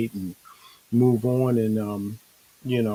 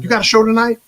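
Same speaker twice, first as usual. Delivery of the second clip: friends asking; 10 semitones higher, 7 dB louder, faster than usual. Retold or imitated. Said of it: "They're like".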